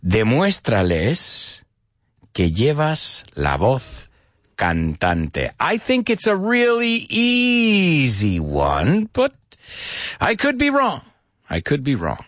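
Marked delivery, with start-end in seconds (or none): 0.1-5.5 slow, in separated chunks with pauses; slow dictation. Shown as a demonstration. Repeats phrase slowly for learners. 10.2-11.0 high-pitched playful admission; playful high aside. Pretend. Playful repeated self-doubt, high take. 11.5-12.2 low muttered aside. Pretend. Same self-doubt, low take.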